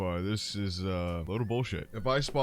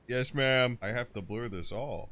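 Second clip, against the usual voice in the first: ordinary talk, nothing marked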